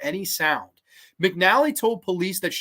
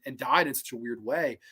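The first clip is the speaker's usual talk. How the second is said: normal talking voice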